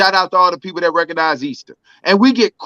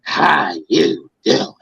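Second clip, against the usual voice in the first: much lower and very slow, a mocking put-on voice; exaggerated boss voice; 7 semitones lower, much slower than usual. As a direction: drag it out like a cartoon villain. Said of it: "He had the nerve to look at me and say".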